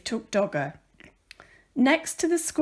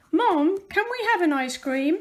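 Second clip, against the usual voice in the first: put-on eager child asking; 5 semitones higher, 4 dB louder than usual. Bella asks mum for ice cream.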